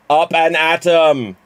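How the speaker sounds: the cartoon way, em not them; lightly voiced cartoon rally; Simpsons catchphrase. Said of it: "do it as Jimmy Mac".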